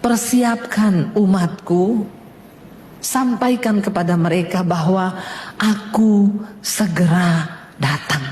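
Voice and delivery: very slow, low solemn proclamation; deep, weighty delivery with long pauses; voice of God. Retold or imitated. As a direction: pronounce it as a divine message, word by word. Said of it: "Tuhan tuh bilang begini".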